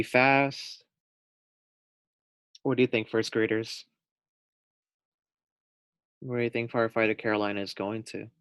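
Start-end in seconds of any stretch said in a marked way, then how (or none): none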